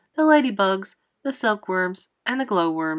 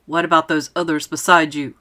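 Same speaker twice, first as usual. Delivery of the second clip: lower-pitched, louder challenge; gruff shout; 4 semitones lower, 6 dB louder than usual. A narrator's reading. Police chief questions the boy above, shouting. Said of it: "shouted the chief of police".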